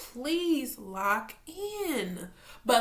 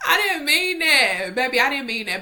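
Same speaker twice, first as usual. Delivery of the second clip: loud, whiny apology to a pet; cooing apology; 3 semitones higher, 8 dB louder than usual. Real, not acted. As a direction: wail it out, then settle. Apologising to dog after scolding.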